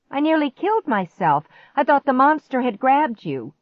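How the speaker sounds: high, loud exasperated outburst; shriek. A narrator's reading. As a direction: scold in upset disbelief. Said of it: "I shrieked".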